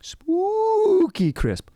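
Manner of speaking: high, drawn-out cartoon mascot howl; hammed-up spooky ad voice; cereal commercial imitation. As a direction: stretch it out like a cereal-ad jingle character. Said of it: "if anyone remembers those commercials".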